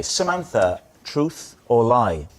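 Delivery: ordinary talk, nothing marked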